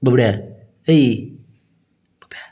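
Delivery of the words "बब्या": whisper. Real, not acted. Owner warns pet as wife arrives.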